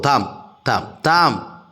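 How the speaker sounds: ordinary talk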